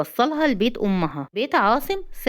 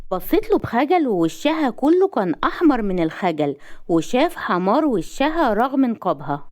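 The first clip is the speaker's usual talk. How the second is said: ordinary delivery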